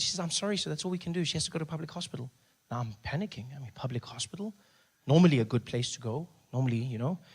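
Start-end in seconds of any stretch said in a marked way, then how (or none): 0.2-2.3 polite nurse. Retold or imitated.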